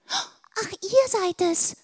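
high character voice. A narrator's reading.

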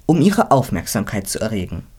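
ordinary delivery

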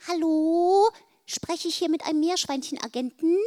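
high, piping little voice; small squeaky caller. A narrator's reading. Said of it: "Es erklang eine piepsige Stimme".

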